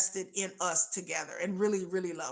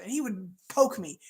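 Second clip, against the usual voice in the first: ordinary talk, nothing marked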